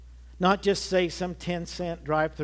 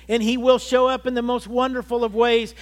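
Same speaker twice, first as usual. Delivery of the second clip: ordinary talk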